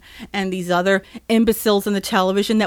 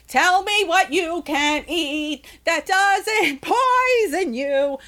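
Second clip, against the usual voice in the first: slow, higher, stretched demand breaking into a laugh; drawn out; 7 semitones higher, slower than usual. Real, not acted. Ranting podcaster presses listeners, slow and laughing. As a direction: drag every word out, mock pleading.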